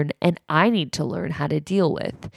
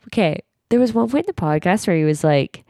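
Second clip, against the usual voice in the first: ordinary talk, nothing marked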